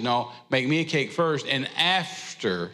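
after drawn out very slowly; slow, weighty after; slow emphasis on after. Shown as a demonstration. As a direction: hold back the pace and let after hang. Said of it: "So now there's an after".

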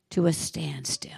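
hushed, breathy stillness; dramatic whisper. A narrator's reading. Preacher hushing congregation at Jesus stopping, awed whisper. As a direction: drop to a whisper for awe. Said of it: "dramatic hush".

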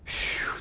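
long breathy "phew" of relief; exaggerated relieved exhale. Young man reacting to his fiancée's news, relieved exhale. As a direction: blow the air out in mock relief. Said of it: "I literally did this".

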